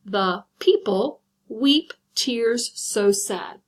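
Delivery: very slow, each syllable separated; checking the syllable count aloud. Shown as a demonstration. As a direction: count out the seven beats slowly. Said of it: "Let's check it".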